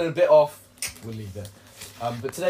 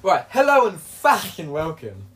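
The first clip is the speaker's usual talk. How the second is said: podcast host intro; 3 semitones higher, 5 dB louder, slower than usual. Acted.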